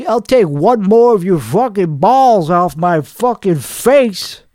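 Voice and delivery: ordinary talk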